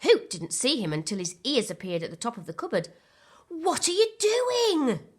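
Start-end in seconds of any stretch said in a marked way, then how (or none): 3.5-5.1 high, slow startled gasp. A narrator's reading. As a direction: gasp it in surprise. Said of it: "she gasped".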